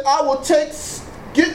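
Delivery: ordinary talk, nothing marked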